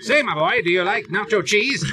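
put-on high, old-fashioned showman voice; hammy stage actor. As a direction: ask it like a jolly vaudeville gent. Old-timey gentleman bit.

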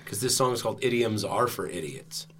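teasing whisper. Real, not acted. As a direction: whisper the jab aside. Teasing aside, heard whispered.

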